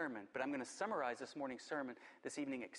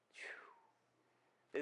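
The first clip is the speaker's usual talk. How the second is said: soft whispered phew; 8 dB quieter than usual. Real, not acted. Exhale after reading verse.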